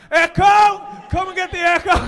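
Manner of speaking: full-throated, high street-seller yell; vendor's shout. Acted. Comedian playing Echo seller on Matthew Street, huge street cry. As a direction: bellow it down a busy street. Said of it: "just shout Echo".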